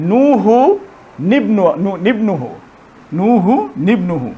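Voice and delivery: নুহু নিবনুহু linked slowly with নি sounded; slow careful recitation of নুহু নিবনুহু; recitation demo. Shown as a demonstration. Teacher repeating the linked reading slowly. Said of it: "এখন কিন্তু উচ্চারণ করা যাচ্ছে".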